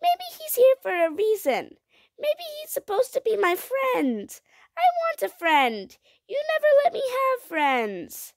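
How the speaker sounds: high, loud, wailing protest; high-pitched impassioned child voice; upset child. A narrator's reading. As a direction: protest like a distressed little girl.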